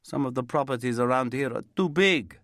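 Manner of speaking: put-on old Italian workman complaining about his helper; Italian accent. A narrator's reading. Roberto moans to Terry about the absent helper, Italian accent.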